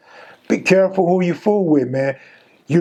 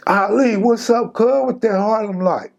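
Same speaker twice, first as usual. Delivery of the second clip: high teasing call to a rival, pitched up; instigator; 6 semitones higher than usual. Retold or imitated. Inmates goading the rival from Harlem, high mock taunt. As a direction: egg the rival on with a raised, needling voice. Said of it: "then you got the instigators".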